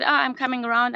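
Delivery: normal talking voice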